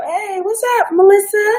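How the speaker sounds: high, bright squeal of a hello; excited greeting. Real, not acted. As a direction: greet her like a long-lost friend.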